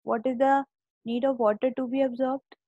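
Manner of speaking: usual speaking voice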